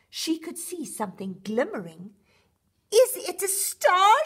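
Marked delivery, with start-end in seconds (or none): 2.9-4.3 high, louder, slow wonder. A narrator's reading. Rocket nearing a glimmer, loud hopeful wonder. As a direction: ask yourself, half hoping. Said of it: "she wondered".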